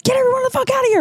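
very high-pitched retold yell; acted-out furious squawk; so angry. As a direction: screech the order without full volume. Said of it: "I was so angry".

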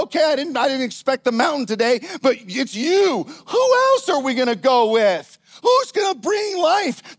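rising passion, ending in a high cry; fired-up resolve. Acted. Acted resolve climbing the mountain.

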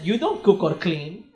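put-on slow, accented mother's complaint; baffled mom in a slow Greek accent; mom's immigrant accent. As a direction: imitate a Greek immigrant mom, drawing words out. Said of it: "when I came out to my mom, she said".

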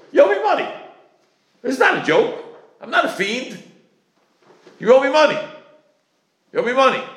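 high-pitched, insistent creditor; insistent demand. Acted. Landlord demanding money from a tenant, insistent and high. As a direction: press the point, each demand on its own.

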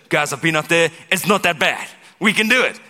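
loud, high, rising hype; cheerleader pep talk. Pretend. Fake-optimist leader to the crowd, loud hype. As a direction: pump them up like a cheerleader. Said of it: "stand up and say".

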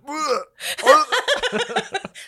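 high laugh. Retold or imitated. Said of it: "I had you in the back of my head going".

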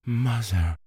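high, small scared call; frightened sigh. A narrator's reading. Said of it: "Meg Giry sighed".

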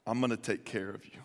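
low, soft and tender; soft promise. Retold or imitated. Boaz promising Ruth he will provide, softly tender. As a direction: say it like a quiet vow. Preacher voicing Boaz's promise.

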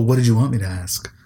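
usual speaking voice

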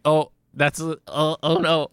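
lightly voiced awkward creature; awkward Twiggly. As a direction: stammer out a guilty oh no. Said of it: "Twiggly looks really awkward".

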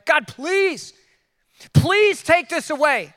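slow, begging please, please; pleading prayer. Pretend. Preacher acting out a desperate prayer to God, pleading. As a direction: beg God to take it away, slowly.

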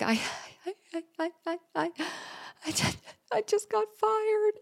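high cracked voice, choked by crying; croaking through tears. Retold or imitated. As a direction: squeeze the words out like a frog through sobs. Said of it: "It was coming out like a frog".

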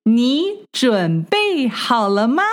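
usual speaking voice

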